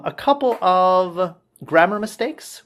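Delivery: ordinary talk, nothing marked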